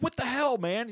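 high indignant outburst; retelling a boss furious after the slap, high indignant yelp; mock angry yelp. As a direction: fire it off high and offended. Michael pissed after the slap.

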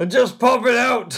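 high, slow, drawn-out holler; loud comic bellow; yell. Acted. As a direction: shout it across a long distance. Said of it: "yell back to Fiddle".

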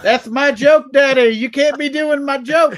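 high, loud whiny complaint; mock outrage. Comic accusing his co-host of stealing his joke, mock whine. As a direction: play the wounded comic, whining.

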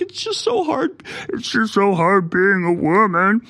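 high, slow whiny fake sob voice; hammed-up crybaby whine; mock fake crying. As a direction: mimic a man faking tears for sympathy. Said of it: "He's, like, pretending to cry".

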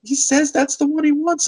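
imitating a doting grandmother, pitched high; grandma voice. Retelling the sweet grandma at the counter, in a high voice. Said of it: "she's like".

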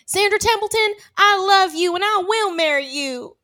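high, loud proclamation; yelled declaration. Retold or imitated. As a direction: yell it across the square. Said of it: "Just fun to yell".

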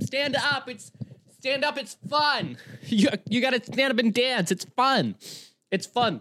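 high-pitched pushy partygoer; pleading imitation. Partygoers begging a bridesmaid to dance, pleading imitation. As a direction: mimic nagging women in a high voice.

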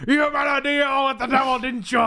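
high, loud mock yell; hammed-up accusation. Plummer confronting his son, over-the-top angry shout. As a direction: accuse him in a comic roar.

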